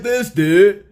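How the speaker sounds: mock dude voice, slowed down. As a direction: exaggerate how he sounds, dude-style. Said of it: "he doesn't really sound like that".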